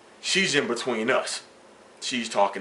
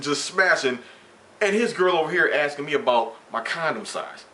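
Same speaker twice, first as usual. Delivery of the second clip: usual speaking voice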